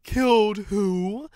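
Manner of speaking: drawn-out indifferent question; a hint of callous Fulcrum. A narrator's reading. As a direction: engine feigning ignorance. Fulcrum answers coldly.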